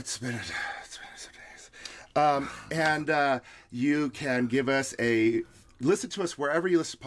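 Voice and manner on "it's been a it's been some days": whispering. Real, not acted. Unvoiced aside.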